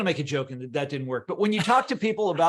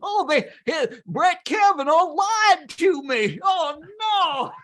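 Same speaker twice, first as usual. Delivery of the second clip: much higher, slow, whiny put-on voice; mocking politician; 12 semitones higher, 6 dB louder, slower than usual. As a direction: whine it like a betrayed senator.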